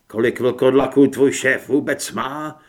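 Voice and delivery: loud shouted question; forceful yell at normal pitch; defiant hero. A narrator's reading. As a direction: shout the challenge at the enemy.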